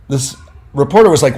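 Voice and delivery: ordinary talk, nothing marked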